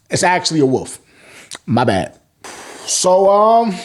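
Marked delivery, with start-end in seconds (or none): none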